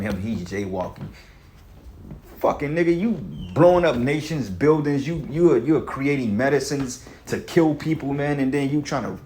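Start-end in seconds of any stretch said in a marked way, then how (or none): 2.4-9.2 worked-up, higher-pitched angry rant; pissed off. Real, not acted. Street preacher accusing the powerful of hypocrisy, pissed off. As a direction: let the anger push your pitch up. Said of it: "I'm getting pissed off now".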